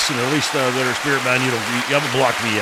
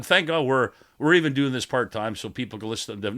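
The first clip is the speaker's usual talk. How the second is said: ordinary talk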